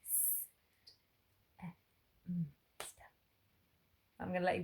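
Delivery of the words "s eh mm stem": whispered sound-by-sound s, t, eh, mm for "stem". Shown as a demonstration. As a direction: break "stem" into soft separate sounds. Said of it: "There's a blend at the beginning".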